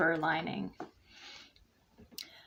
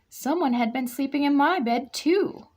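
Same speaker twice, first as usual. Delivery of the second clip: higher, louder mama bear; crying out; 6 semitones higher, 5 dB louder than usual. A narrator's reading. Mama Bear finding her bed used, high loud cry. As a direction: cry it out in alarm. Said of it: "Mama Bear cried".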